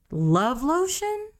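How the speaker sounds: high, slow disbelief; incredulous. Real, not acted. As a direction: stretch it in disbelief. Repeating absurd phrase.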